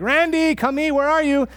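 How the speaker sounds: high, loud calling mom voice; high-pitched mom calling her son to eat; mom calling Randy. Retold or imitated. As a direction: call out for the kid like a mother in the kitchen. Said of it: "and he's like".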